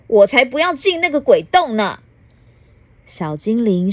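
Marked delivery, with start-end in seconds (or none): 0.1-2.0 a touch higher, hammed-up angry refusal; a grump refusing in a huff; mock flustered scolding. Rude gentleman refuses the hole, mildly mock angry. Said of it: "气急败坏地骂".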